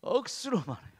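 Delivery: playful touch of Gyeongsang speech; Gyeongsang dialect. Shown as a demonstration. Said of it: "경상도 말로".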